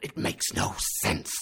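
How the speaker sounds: angry shout. Acted. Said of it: "shouted my uncle".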